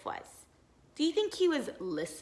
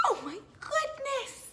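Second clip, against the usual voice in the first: amazed; 12 semitones higher than usual. Real, not acted. Amazed aside to children.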